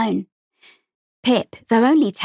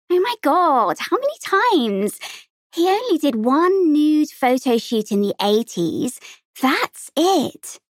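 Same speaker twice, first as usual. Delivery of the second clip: higher, mock-outraged young girl; exasperated protest; 6 semitones higher than usual. A narrator's reading. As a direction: roll your eyes, protest it's been said too often. Lauren protests the old teasing.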